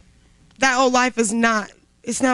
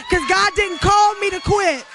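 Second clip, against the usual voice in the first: high, fervent testimony; rousing declaration; 7 semitones higher than usual. Real, not acted. Testifying to the cheering women, fervent declaration. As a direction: raise your voice to rally the room.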